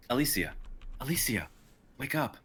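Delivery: urgent calls to wake her; calling a sleeper urgently. Acted. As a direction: shake her awake with your voice. Waking a sleeping friend.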